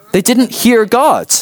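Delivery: high, slow, emphatic. Real, not acted. Preacher telling the congregation the crowd missed God, high and slow. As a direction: land the point with disbelief. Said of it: "emphatic punchline".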